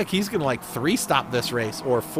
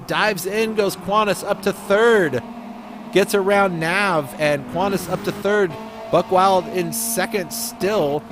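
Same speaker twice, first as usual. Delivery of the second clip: play-by-play; 4 semitones higher than usual. Acted. Live play-by-play calls.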